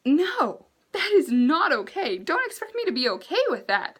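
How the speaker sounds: high-pitched, emphatic protest; indignant refusal. Acted. Vlogger rejects the man's crude proposition, indignant.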